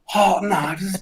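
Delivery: crowd cheering. Retold or imitated. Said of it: "this entire audience were like".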